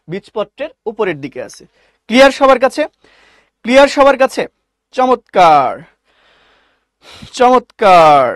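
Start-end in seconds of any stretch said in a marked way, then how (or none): 2.1-4.4 loud, higher check-in to the class; calling out. Real, not acted. Teacher calling to online students, loud. 5.0-8.2 excited praise. Real, not acted. Teacher praising class loudly.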